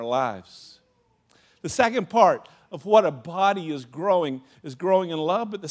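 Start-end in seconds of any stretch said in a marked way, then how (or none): none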